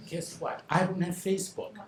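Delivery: normal talking voice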